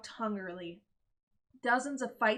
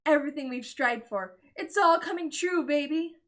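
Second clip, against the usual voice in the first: elated; 4 semitones higher, 7 dB louder than usual. Acted. Father elated.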